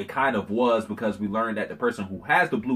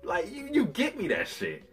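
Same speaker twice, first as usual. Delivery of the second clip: higher, brash don't-care tone; defiant Orochi; 6 semitones higher than usual. Retold or imitated. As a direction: toss it off like an arrogant boss. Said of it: "He's like".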